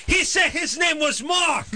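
high, slow caricature of a phone clerk; shrieky, drawn-out insistence; restaurant worker. Acted. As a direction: whine it slowly at high pitch.

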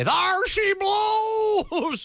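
high, drawn-out yell; mock shout. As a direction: bellow it like a sailor. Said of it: "just have him say".

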